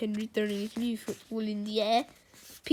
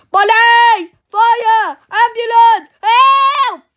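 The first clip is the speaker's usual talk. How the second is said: very loud, high cries for help; frantic full-voiced emergency calls; panicked shouting; 12 semitones higher, 19 dB louder, much slower than usual. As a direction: yell each word in escalating panic. Teacher screaming for emergency help.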